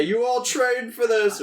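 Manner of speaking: half-improvised heroic pep talk; vaguely heroic. Acted. Player's character rallying scared soldiers, vaguely heroic. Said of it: "Some vaguely heroic shit".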